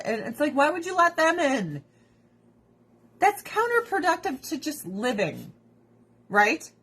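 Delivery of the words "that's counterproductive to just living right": normal talking voice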